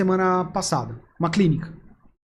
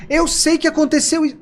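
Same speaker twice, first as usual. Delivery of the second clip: higher, insistent but not confrontational; voice raised to match; 7 semitones higher than usual. Shown as a demonstration. Agent connecting with a shouting client, raised voice without affront. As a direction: lift the voice to meet an upset customer. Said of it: "você sobe a língua, a, a voz também".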